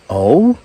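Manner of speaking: oh? with a slight rising inflection; inflected oh. Retold or imitated. To the yuppie mother, a hopeful probing oh. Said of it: "Trying to inflect it slightly differently".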